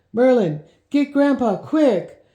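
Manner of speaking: loud, high urgent order; urgent call. Acted. Boy sending the hawk for help, loud and high. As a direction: send the bird off in a panic.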